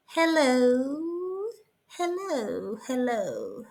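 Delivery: lightly done royal English accent; posh English hello. Shown as a demonstration. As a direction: greet like English royalty, sinking down. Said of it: "as if I was English royalty".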